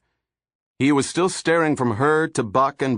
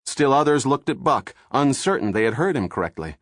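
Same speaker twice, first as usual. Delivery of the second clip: plain reading voice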